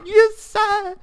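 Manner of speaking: high, loud, over-the-top yes-sir; mock obedient squeal. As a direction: squeal it like a hammed-up recruit. Acts out obedient reply.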